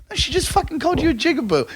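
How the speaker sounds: high, fast, heated retelling; mock outrage at the insult; retold angry outburst. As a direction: fire it back fast and high in disbelief. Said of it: "And I'm like".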